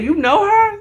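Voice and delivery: high questioning tone; suspicious question. Retold or imitated. Tarika probing Charisse about Alicia, high and suspicious.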